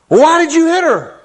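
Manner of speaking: high, loud exasperated parent asking. Retold or imitated. Parent scolding the son who hit his sister, exasperated. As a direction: demand an answer like a fed-up mom.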